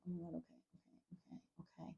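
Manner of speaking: barely audible whispered self-talk; panicked whisper. Retold or imitated. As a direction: act out whispering to yourself while hiding. Acted frightened whisper while hiding.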